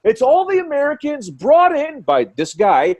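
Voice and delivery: high, loud indignant tirade; mock yelling. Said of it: "he starts yelling at the cops".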